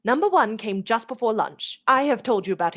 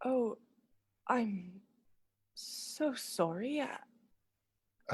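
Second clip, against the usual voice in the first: much softer, slow, hushed regret; soft regretful apology; 10 dB quieter, much slower than usual. Acted. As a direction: let him down gently. Lucy regretfully refusing.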